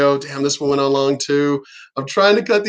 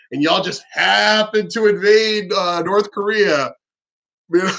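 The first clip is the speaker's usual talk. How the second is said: slow, higher, with HAPPEN drawn right out; sly mock hint; 4 semitones higher than usual. Imagined president nudging China to invade, slyly drawn out. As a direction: stretch it out like a wink-wink suggestion.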